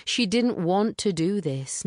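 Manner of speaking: ordinary delivery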